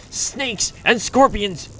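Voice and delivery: normal talking voice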